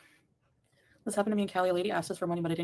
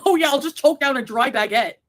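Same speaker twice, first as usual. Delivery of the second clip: loud mocking sarcasm; mock him sarcastically; sarcastic; 5 semitones higher, 6 dB louder than usual.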